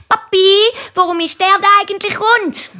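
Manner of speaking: hohe, laut rufende Kinderstimme; löchere den Papi mit hoher Kinderstimme; fragendes Kind. A narrator's reading. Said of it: "stellt, äh, Nina und der Christoph ihrem Papi ständig Fragen". (hochi Chinderstimm, fröglet)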